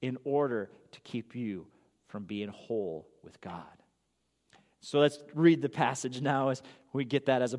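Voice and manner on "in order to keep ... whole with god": drops to a whisper; whispered warning to the congregation; hushed whisper. Real, not acted. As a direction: breathe the words out softly, almost secret. Warning of the enemy's aims.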